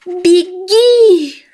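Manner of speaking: high panicked mouse shout. Acted. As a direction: yell the warning high. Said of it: "закричала полевая мышь".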